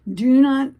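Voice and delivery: loud, emphatic do not. Real, not acted. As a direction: hit it hard and loud. Urging people not to scream.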